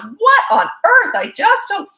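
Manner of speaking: high, loud mock outburst; exasperated parent. Parent seeing toddler repeat it, high loud exasperation. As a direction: throw up your hands in mock disbelief.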